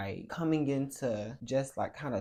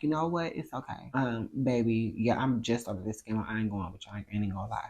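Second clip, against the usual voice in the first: fed up; 3 semitones lower, 7 dB louder than usual. Retold or imitated. Fed up with the game.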